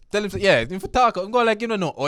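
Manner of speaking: high, loud playful jabber; animated teasing outburst; teasing excited banter. Real, not acted. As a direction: goad him on laughing.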